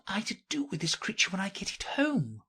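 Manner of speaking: put-on high, soft girlish character voice; Alice pondering. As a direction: think aloud, high and soft. Alice wondering about the creature.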